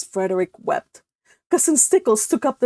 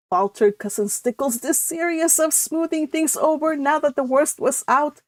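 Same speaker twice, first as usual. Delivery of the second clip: plain reading voice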